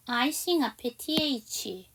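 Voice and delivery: usual speaking voice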